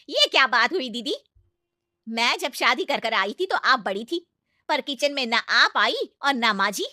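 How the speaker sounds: loud, indignant complaint; aunt loudly objecting to the elder sister; indignant protest. Acted.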